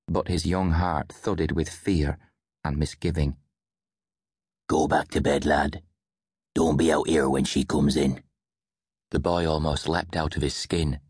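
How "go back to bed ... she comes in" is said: put-on old man. A narrator's reading. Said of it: "he saw the old man".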